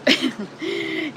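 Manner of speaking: soft little laugh; short laugh. Real, not acted. Host amused by the herb question, soft laugh. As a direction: laugh lightly at your own question.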